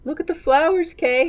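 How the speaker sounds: high, gentle soothing voice; tender mock comfort. Mock mercy-killing scene.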